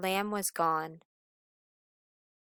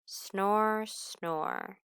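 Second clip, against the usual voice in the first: gentle snore. Acted. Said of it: "snored gently".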